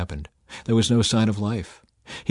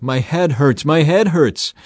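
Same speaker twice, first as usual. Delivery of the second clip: higher, louder cry, building on the repeat; narrator's acted cry of a hurting child; crying out in pain; 6 semitones higher, 6 dB louder, slower than usual. A narrator's reading. Child to his father in the field, crying out in pain. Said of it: "Suddenly he cried out".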